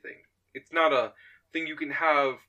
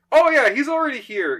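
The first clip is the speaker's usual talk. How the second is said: bright eager answer; 10 semitones higher, 8 dB louder than usual. Acted. Voices Nick's eager sudden answer.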